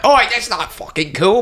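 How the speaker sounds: high, put-on English accent; mock English accent. Imitating people objecting to impressions, mock English accent. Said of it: "like, a fucking English accent".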